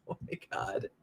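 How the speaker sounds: breathy whispered aside; a hushed oh my God. Real, not acted. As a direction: drop to a whisper in disbelief. Reacting to chat.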